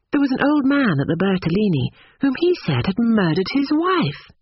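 put-on high, earnest young woman; indignant Lucy.

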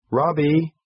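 high, mildly urgent call. A narrator's reading. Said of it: "she called out a bit urgently".

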